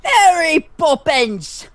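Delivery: high, loud, drawn-out bad Cockney accent. Shown as a demonstration. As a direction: belt out a hammed-up Cockney, stretching each word.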